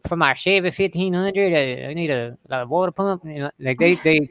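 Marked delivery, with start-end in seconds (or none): 0.1-3.2 put-on slurring old Southern men. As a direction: mumble it together like an old Southern customer. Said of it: "they slur it bad".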